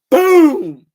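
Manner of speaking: loud shouted explosion boom. Retold or imitated. Streamer retells the car bomb scene with a loud boom. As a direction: yell the boom like a car blowing up.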